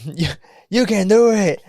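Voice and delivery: high, drawn-out mock cheer; catchphrase imitation. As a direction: egg them on in a put-on high voice. Imitating a film catchphrase heckler.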